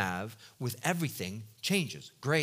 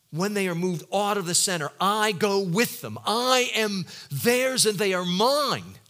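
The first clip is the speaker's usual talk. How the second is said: building declaration; 4 semitones higher, 6 dB louder than usual. Retold or imitated. Jesus's voice building in conviction.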